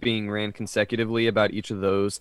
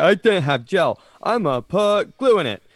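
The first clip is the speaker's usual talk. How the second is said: a higher, hammed-up dim voice, the second line slow; mock clueless adult; 7 semitones higher, 5 dB louder than usual. Podcaster mocking the glue lady, in a high, dim voice. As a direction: mimic a dim-witted adult explaining herself.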